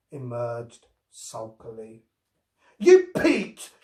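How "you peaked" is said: high, loud accusing cry; indignant exclamation. A narrator's reading. Sulky girl accusing Robbie of cheating, loud. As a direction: accuse him unfairly. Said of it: "she exclaimed with gross unfairness".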